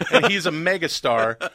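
ordinary delivery

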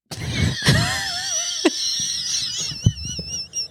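high, shrieking laugh-cry. Real, not acted. Overwhelmed telling the church story, laugh-crying. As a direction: burst between tears and laughter.

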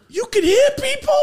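put-on very high, loud kid-like disbelief; amazed yell. Kid to uncle on discovering the game, amazed yell. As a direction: yell it in thrilled astonishment, way up high.

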